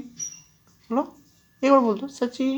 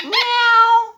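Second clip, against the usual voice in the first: cat meow; 13 semitones higher, 5 dB louder, much slower than usual. Shown as a demonstration. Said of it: "बिल्ली की आवाज निकल।".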